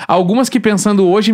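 ordinary delivery

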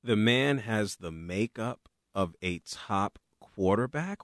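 slow, low sarcastic echo of the quote; incredulous scoff. Real, not acted. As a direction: repeat the claim slowly as if it's absurd. Scoffing at the owner's claim.

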